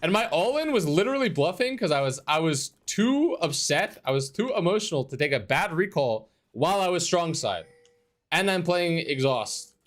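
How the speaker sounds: high-pitched exasperated ranting; frustrated rant. Real, not acted. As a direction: vent the frustration, voice climbing. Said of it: "I'm so frustrated".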